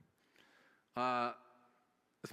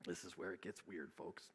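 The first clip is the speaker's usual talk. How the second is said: much softer, confiding to the folks; hushed aside; 7 semitones lower, 10 dB quieter than usual. Real, not acted. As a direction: lean in, drop it low. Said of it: "aside to the congregation".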